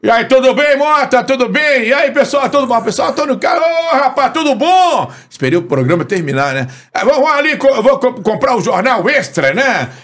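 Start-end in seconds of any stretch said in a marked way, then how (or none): 0.0-5.1 very high-pitched radio host greeting everyone; Antônio Carlos. Acted. Radio host coming downstairs greeting staff, high-pitched impression. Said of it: "Aí ele desce". 6.9-9.9 high-pitched imitation of a radio host; Antônio Carlos. Radio host off to buy a newspaper, high-pitched impression. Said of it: "Aí ele desce".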